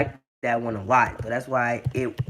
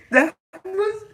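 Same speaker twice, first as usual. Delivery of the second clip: very high, words squeezed out by laughter; real laughing fit. Real, not acted. Host praising the joke, squeaking with laughter.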